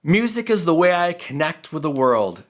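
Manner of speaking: ordinary delivery, nothing marked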